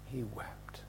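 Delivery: a breathy whisper, no voice at all. Acted. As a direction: drop to a whisper to draw them in. Repeating how Jesus wept.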